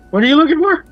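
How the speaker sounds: high squeaky little voice. Acted. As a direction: squeak it out nervously. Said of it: "he says in a squeaky voice".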